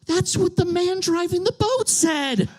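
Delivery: high, slow gasped squeal; gasp, then gush in an imitated woman's voice; hammed-up thrilled realization; smiling, delighted outburst. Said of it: "starts smiling and she goes".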